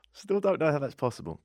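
calmer, muted bafflement; subsiding disbelief. Acted. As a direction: repeat it as the outburst dies down. Repeats disbelief after the outburst.

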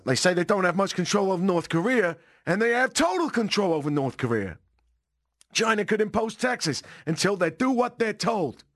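high-pitched mock politician; Donald Trump. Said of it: "do the rest of the show as Trump".

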